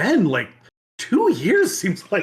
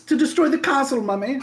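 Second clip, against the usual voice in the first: put-on whiny child; 9 semitones higher than usual. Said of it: "Can I come out to destroy this".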